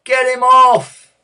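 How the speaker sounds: long, high, very loud heckle; drawn-out roar from the stands; crowd shout. A narrator's reading. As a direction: bellow it slowly like an angry crowd. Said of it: "people began to shout".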